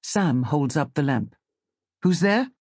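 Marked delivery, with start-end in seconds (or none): 2.0-2.5 higher, startled challenge; startled call. Acted. Fisherman calling out to unseen voices, startled. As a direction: call out in alarm with a sharp rise. Startled man calls into the dark.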